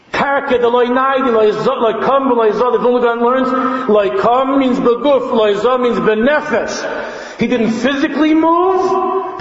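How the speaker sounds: high, insistent sermon cry; impassioned high-pitched preaching; full-force preaching. Real, not acted. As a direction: drive each point home on a high pitch.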